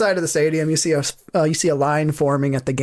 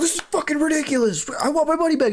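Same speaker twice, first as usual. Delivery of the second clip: angry gambler, high; 11 semitones higher, faster than usual. Acted. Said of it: "He's like".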